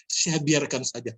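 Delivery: ordinary delivery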